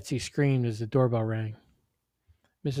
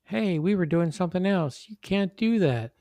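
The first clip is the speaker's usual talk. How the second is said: put-on higher, louder boy's protest; yelling; 7 semitones higher, 5 dB louder than usual. A narrator's reading. Boy objecting to the mother's role-play, yelling. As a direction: yell out an objection, voice raised. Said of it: "Charlie yelled".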